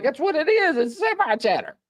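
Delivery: high-pitched, retold protest; insistent retort. Comedian retelling answer to annoyed friend, high insistent protest.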